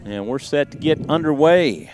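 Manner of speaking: steady radio baseball call; play-by-play. Acted. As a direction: call the game live.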